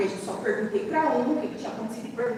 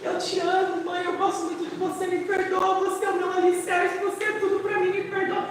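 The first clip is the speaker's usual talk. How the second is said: higher, pleading daughter; tearful apology; 7 semitones higher than usual. Retold or imitated. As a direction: mimic the daughter begging forgiveness. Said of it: "e começou a chorar".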